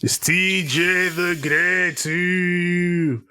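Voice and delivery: slow, high grand introduction; grand announcer. Acted. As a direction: stretch it out like a ring intro.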